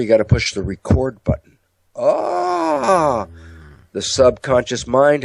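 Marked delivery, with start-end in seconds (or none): 1.9-3.3 high, sustained "aah" of revelation; exaggerated dawning-insight cry, pitched way up; drawn-out "ah" of realization. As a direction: let out a long, high mock-enlightened "ahh". Acted sudden moment of understanding.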